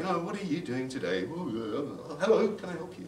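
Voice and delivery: lightly voiced kids' TV character, lilting; swooping pitch, acting a children's show phone worker; Colin the call centre worker. Kids' show host greeting call centre Colin, lilting. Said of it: "the call center worker".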